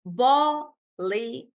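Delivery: "Bollywood" slowly as Bol-ly-wood; three spaced beats on "Bollywood"; syllable by syllable. Shown as a demonstration. Teacher checking a student's answer, syllable by syllable. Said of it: "it's three".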